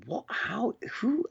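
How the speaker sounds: squeaky high amazed questions; mock astonished what how who. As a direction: gasp out each question in wonder. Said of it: "the one that really got me was like".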